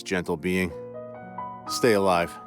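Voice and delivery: ordinary delivery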